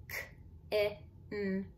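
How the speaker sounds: "k-i-n" as three separate letter sounds; sounding out. Shown as a demonstration. Teacher spelling the second syllable, sounding out. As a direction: tap out "k-i-n" one sound at a time. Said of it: "you wanna spell by syllable".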